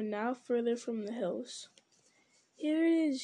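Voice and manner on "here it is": raised, higher-pitched cry; excited cry. A narrator's reading. Gollum showing the hobbits the way down, mild excited cry. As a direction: mildly exclaim a discovery. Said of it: "he cried".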